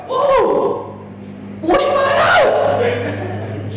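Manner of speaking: high-pitched, drawn-out yell of mock shock; comic shriek at the price. Dad reacting to the price of baby Jordans, shocked high shout.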